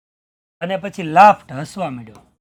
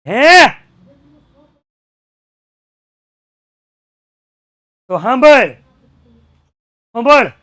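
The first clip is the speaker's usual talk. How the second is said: loud, higher menacing shout; threatening shout; 5 semitones higher, 14 dB louder than usual. Retold or imitated. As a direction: demand he listen, menacingly. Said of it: "villain threatens priest".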